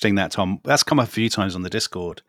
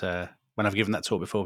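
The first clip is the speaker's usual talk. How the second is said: ordinary talk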